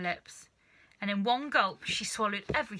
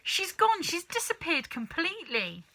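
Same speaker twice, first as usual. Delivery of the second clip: crying out; 9 semitones higher than usual. Acted. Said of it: "cried Missus Cranky".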